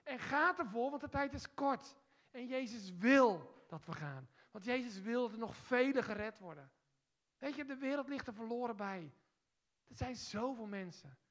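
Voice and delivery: loud, high, forceful preaching; fiery revival preacher; preaching at full force. Acted. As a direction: proclaim it to the whole hall. Urging congregation to go.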